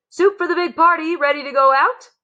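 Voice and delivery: high, loud kitchen call; cook calling out. A narrator's reading. Cook calls to the waiter, loud and high. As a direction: sing out the soup order to the staff.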